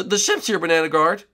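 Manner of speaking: ordinary talk, nothing marked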